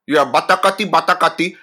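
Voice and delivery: mock his accent with nonsense syllables; Joe's mock accent. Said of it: "yes, that's how he sounded".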